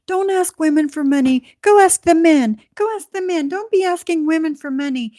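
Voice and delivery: mock her going off at him; mocking rant. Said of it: "Maria is going off on this dude saying".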